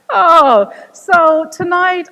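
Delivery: ordinary talk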